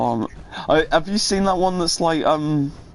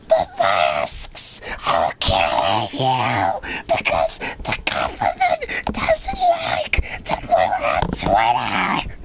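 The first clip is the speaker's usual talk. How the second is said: mock Karen rant; 6 semitones lower, much slower than usual. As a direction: wail it like an unhinged conspiracy Karen. Mimicking a ranting Karen.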